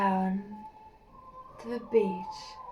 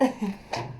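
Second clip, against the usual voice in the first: breathless laugh; 2 semitones lower, 6 dB quieter than usual. Real, not acted.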